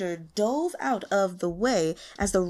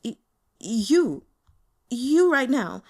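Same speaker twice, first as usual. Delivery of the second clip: loud, stammering, frightened; trembling stammer; 3 semitones higher, 5 dB louder, slower than usual. Acted. Scared intern naming his boss as his fear, shaky stammer. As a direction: point and stutter, terrified. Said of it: "raised a shaking hand".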